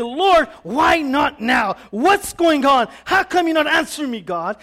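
loud, high-pitched protest in prayer; complaining to God. Acted. Believer to God over unanswered prayer, complaining loudly. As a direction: cry out your grievance to heaven. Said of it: "we end up complaining and".

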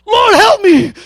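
high, loud comic plea; mock desperate cry. Preacher joking about his wife's fights, loud mock cry to the Lord. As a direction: cry out like a fed-up husband. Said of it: "I cry out daily when my wife fights".

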